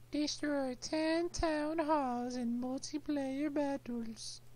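very high, drawn-out silly read-out; put-on read-out. Gamer reads the battle task to viewers, silly high voice. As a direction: squeal the task out slowly.

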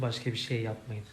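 usual speaking voice

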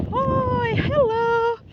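high, long, loud holler; very high sustained greeting call. Real, not acted. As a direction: holler hello to someone far off. Calling out to distant animals.

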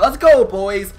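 loud, high hype cheer; frat bro. Real, not acted. Cheering the round win.

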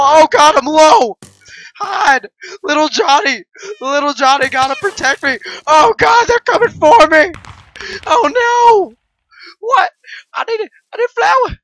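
high, frantic yelping; panicked. Real, not acted. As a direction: scramble in mock panic. Being chased, panicking.

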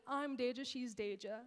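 ordinary delivery

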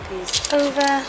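ordinary talk, nothing marked

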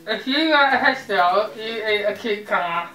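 talking around a mouthguard; words muffled by a gum shield; wearing a mouthguard. Real, not acted. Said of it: "the mouth guard challenge".

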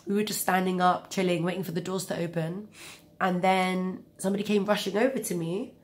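usual speaking voice

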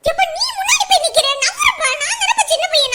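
loud, high-pitched squabbling; indignant shout. Acted. Quarrelling over a place in the queue, shouting indignantly. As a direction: shove in with your voice.

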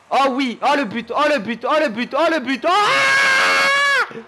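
high chant building to a long scream; real gamer frenzy; mounting excitement. Real, not acted. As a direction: build urgently into a full shout. Goal coming, then scream.

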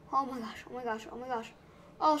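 ordinary talk

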